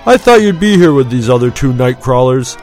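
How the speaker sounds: a lightly voiced self-assured pilot; confident, near smug. A narrator's reading. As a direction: tease him with easy confidence. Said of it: "confidence bordered smugness".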